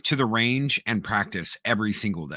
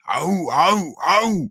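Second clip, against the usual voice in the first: higher-pitched war chant shout; Spartan war cry; 7 semitones higher, 4 dB louder, much slower than usual. Retold or imitated. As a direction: shout it like soldiers answering their leader.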